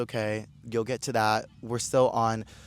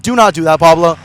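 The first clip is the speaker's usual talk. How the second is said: warning yell; 4 semitones higher, 12 dB louder than usual. Retold or imitated. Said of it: "everybody was like".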